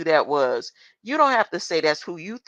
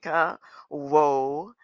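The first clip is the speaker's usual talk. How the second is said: usual speaking voice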